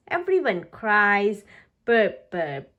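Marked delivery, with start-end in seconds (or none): 1.8-2.8 low put-on burp sound; mock burp. A narrator's reading. Piglet burping at lunch after gobbling. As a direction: fake a little burp.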